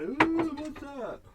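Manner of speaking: squeaky, very high, startled duck voice. Retold or imitated. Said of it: "They were like".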